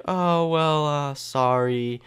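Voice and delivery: high, drawn-out mock apology; nurse's sheepish apology. Nurse apologizing to the wronged patient, high and drawn-out. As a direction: stretch out a lame, sing-song sorry.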